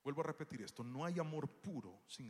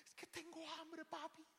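put-on child voice; 14 semitones higher, 6 dB quieter, slower than usual. Daughter voiced in story.

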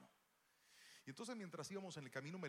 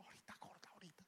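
sleepy whisper; 4 semitones higher, 14 dB quieter, faster than usual. Retold or imitated. Dad stalling with eyes closed.

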